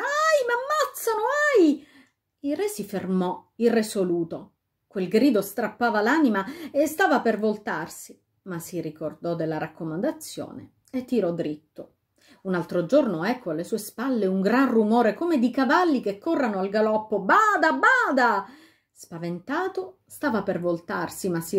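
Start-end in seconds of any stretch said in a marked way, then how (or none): 0.0-1.9 high, piercing cry for help; a victim shrieking for rescue; terrified scream. A narrator's reading. A voice behind the king screams for help. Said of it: "un urlo di creatura umana". 17.2-18.5 high, loud cry of alarm; urgent warning shout. A narrator's reading. A voice behind the king shouts a warning.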